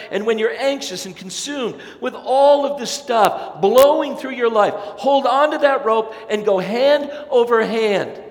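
loud, high, urgent sermon climax; full-force preaching. Acted. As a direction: drive it home to the congregation.

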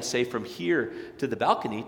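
ordinary talk

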